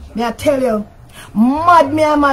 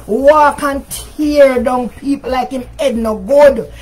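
ordinary delivery

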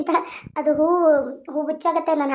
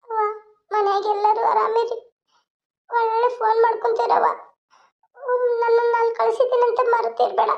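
high, pleading voice of a daughter saying goodbye; a bride begging her family not to forget her, higher; young bride leaving home; 8 semitones higher than usual. Acted. Creator voices the departing bride. (ತವರು ಬಿಟ್ಟು ಹೋಗುವ ಮಗಳ ಎತ್ತರದ ಬೇಡಿಕೆಯ ದನಿ)

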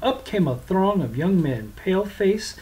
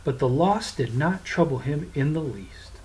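usual speaking voice

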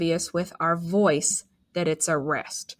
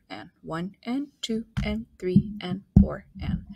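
whispered counting; counting under breath; 3 dB quieter than usual. Shown as a demonstration. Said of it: "everything that we write".

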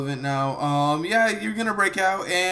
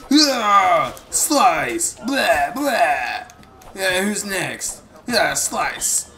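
fighting shouts; 2 semitones higher, 5 dB louder, much slower than usual. Acted. Fighting cries in game.

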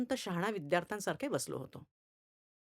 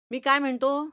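loud, high angry demand; 6 semitones higher, 8 dB louder than usual. Acted. Angry teacher demands an answer from the silent boy. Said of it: "संतापले".

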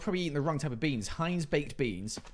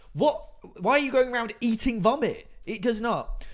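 incredulous outburst; 9 semitones higher, 6 dB louder than usual. Real, not acted. Reacting to chat's vomit claim.

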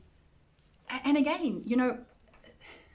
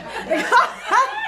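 loud, high burst of laughter; real, unrestrained belly laugh; delighted laugh; 10 dB louder than usual. Real, not acted. Teacher hearing a perfect Sunday school answer, delighted laugh.

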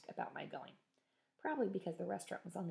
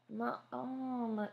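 complaining; 6 semitones higher than usual. Acted. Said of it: "Sylvie complained".

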